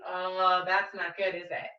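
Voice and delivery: plain reading voice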